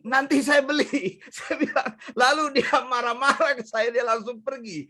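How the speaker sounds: high-pitched, amused storytelling broken by laughs; laughing. Real, not acted. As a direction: chuckle through the retelling. Geli menceritakan akalnya mengerjai penipu. (bercerita sambil tertawa geli)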